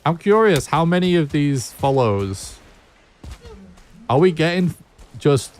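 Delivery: high, eager question; excited curiosity. Real, not acted. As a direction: ask it with genuine excitement.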